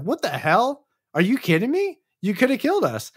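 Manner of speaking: high-pitched mock outrage; indignant confrontation. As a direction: act out an incredulous telling-off, pitched high. Sopra confronts the shooter, acted.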